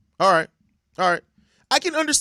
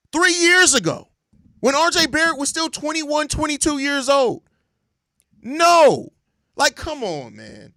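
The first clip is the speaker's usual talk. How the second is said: exasperated outburst; 8 semitones higher, 4 dB louder than usual. Real, not acted. Exasperated rant about trade rumor.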